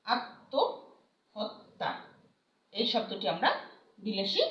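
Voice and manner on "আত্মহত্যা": আত্মহত্যা drawn out slowly, m silent, doubled t; আত্মহত্যা as attohotta, stretched out; slow careful pronunciation. Shown as a demonstration. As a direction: spell out আত্মহত্যা sound by sound, very slowly. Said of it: "মটা উচ্চারণ হচ্ছে না".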